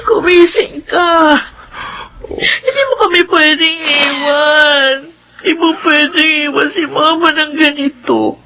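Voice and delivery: sobbing plea. Acted. Mother over her dead baby.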